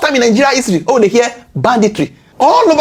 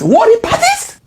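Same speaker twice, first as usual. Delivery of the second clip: "Warring parties?" shot up high in disbelief; can't believe the phrase "Warring parties"; incredulous question; 13 semitones higher than usual. Real, not acted. Questioning the president's wording, high and disbelieving.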